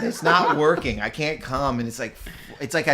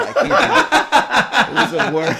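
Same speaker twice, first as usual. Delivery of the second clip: laughing wail; 11 semitones higher, 8 dB louder, slower than usual. Real, not acted. Wailing about bombing a set.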